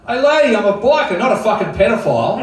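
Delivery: loud, high-pitched mock outrage; indignant retort. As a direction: call out the lady, offended. Acted indignant retort to wary mother.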